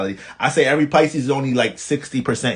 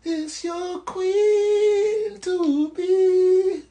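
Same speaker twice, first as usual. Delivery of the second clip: very slow, much higher, put-on voice; mock regal introduction; much slower than usual. Introducing the angelic Pisces girl at the cookout, slow mock-royal voice. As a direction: announce her like royalty, drawn out. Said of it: "looking like a motherfucking".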